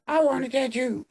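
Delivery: put-on very high, fast, whiny girl voice; a hammed-up sulky teen dodging; whiny mock Emma. Mocking Emma refusing to talk about Kieran. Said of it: "Emma's like".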